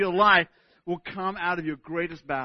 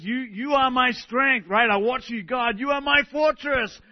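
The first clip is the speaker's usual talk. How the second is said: fervent reading; 7 semitones higher, 6 dB louder, slower than usual. Acted. Reading psalm with fervour.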